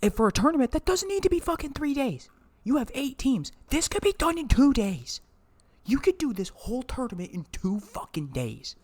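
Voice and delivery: high, loud, fed-up complaint; angry rant about a three-day event; exasperated rant. Real, not acted. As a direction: vent that it could be two days. Ranting about three-day tournament.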